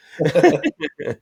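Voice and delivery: a sudden high burst of laughter; high cackling laugh. Real, not acted. Laughing at his buddy turning down the cigar, high loud cackle.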